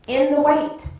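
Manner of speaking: high, loud sermon call; preacher's refrain. Real, not acted. Preacher urging listeners to remember their assignment, emphatic preaching. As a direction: punch it out to the congregation.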